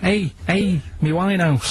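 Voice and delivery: a Scouse fella telling off a burglar; Scouse accent. Shown as a demonstration. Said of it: "this is gonna be a pronunciation thing".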